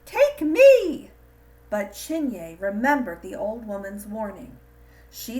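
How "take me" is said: very high, loud eerie cry; crying gourd. A narrator's reading. Evil gourd cries out to Chinye, high eerie shriek. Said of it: "a voice cried".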